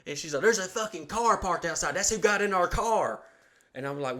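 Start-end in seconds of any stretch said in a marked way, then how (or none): 0.4-3.2 high, urgent imitation of a wife; insistent alarmed wife. Wife tells husband about the break-in, high insistent alarm. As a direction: press the alarm home in a raised pitch.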